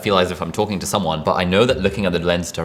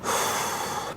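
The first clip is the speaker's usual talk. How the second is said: long audible exhale through the mouth; calming deep exhale; deep breath out; 9 dB quieter than usual. Shown as a demonstration. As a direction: breathe out slowly and fully. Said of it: "take a deep breath".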